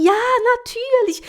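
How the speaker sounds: high cheerful natürlich; bright German reply. Retold or imitated. Manicurist replying in German, high and bright. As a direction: answer brightly. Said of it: "Aí ela falou assim".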